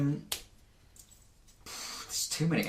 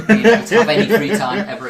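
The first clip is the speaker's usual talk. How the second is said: laughing; 9 semitones higher, 13 dB louder, faster than usual. Real, not acted. Mock curse joke, laughing.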